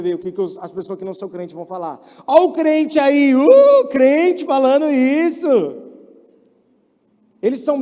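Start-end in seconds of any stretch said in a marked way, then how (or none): 2.3-5.5 loud, slow, high jeering taunt; mocking coworker. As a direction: sneer at the believer.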